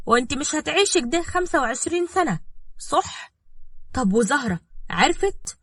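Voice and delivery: high, scheming lilt; sly. Acted. Woman nudging girl to abandon jailed man, slyly. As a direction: lead her on slyly to agree. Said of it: "وصفاء كملت بمكر".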